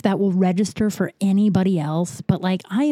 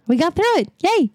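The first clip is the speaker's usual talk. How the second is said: little celebration; 10 semitones higher than usual. Real, not acted. Celebrates getting through the episode.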